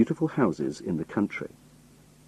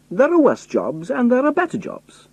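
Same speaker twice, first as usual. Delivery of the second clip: put-on strong Scottish accent, pitched much higher; a roadman's broad Scottish accent. Narrator answers the spies in a Scottish accent. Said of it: "I spoke in Alexander Turnbull's strong Scottish accent".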